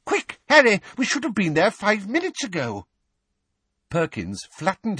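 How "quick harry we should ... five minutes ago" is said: urgent. A narrator's reading. Weasley rushing Harry.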